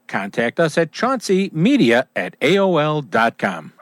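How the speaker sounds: upbeat, punchy commercial voice; radio ad read. Acted. Announcer reading a boat dealer ad to listeners, upbeat ad read. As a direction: sell it like a local radio spot.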